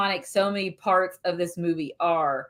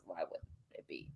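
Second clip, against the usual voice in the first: whispered aside; 23 dB quieter than usual. Real, not acted. Sarcastic aside about the hero.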